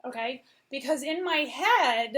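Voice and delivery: ordinary talk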